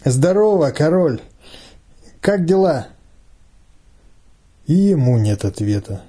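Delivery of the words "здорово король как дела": slightly put-on, higher-pitched greeting; forced friendliness, lightly raised pitch; unnatural voice. A narrator's reading. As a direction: greet the leader in a voice not quite yours. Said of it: "чужим голосом говорит".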